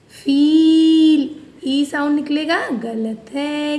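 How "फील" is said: फील drawn out very slowly and loud; blending the word feel. Shown as a demonstration. Teacher landing the word फील for the class, drawn out. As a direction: hold the ई in फील long and strong. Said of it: "double एल, ल".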